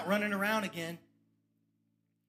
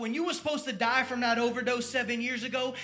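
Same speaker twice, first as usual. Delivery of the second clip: rising, louder preaching as the church lady; church lady prophecy; 3 semitones higher, 6 dB louder than usual. Retold or imitated. Church lady prophesying to a young addict, building to loud preaching. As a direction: bless him like a church mother.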